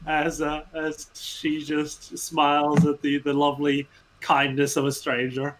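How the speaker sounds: usual speaking voice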